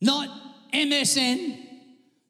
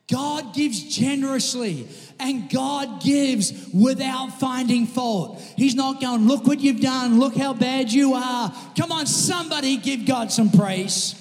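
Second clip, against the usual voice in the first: loud, emphatic preacher; full-voiced sermon climax; rousing preaching; 3 semitones higher, 5 dB louder than usual. Real, not acted. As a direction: drive it louder, rallying the congregation. Preacher rousing congregation to praise.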